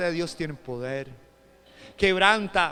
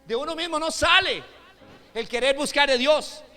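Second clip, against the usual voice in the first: loud, high-pitched pulpit shout; full-force preaching; 10 semitones higher, 13 dB louder than usual. Real, not acted. Preacher telling the congregation the Spirit convicts of sin, shouted preaching. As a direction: drive each phrase home at full volume.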